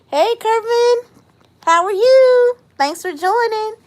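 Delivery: high, loud welcoming call; excited greeting. Real, not acted. Thanking a viewer for joining, excited and high. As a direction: sing out a big hello, high-pitched.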